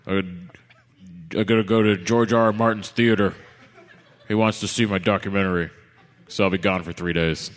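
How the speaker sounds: lightly imitating a buddy bailing on plans; loose, joking impersonation of a friend cancelling; Mosier impression. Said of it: "I'm gonna do my best Mosier impression".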